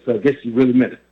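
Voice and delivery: ordinary delivery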